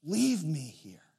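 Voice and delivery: soft, broken little plea, fading away; a hurt child begging to be left; a daughter hiding in the leaves, quietly pleading to be left; quiet tearful child. Retold or imitated. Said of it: "she said".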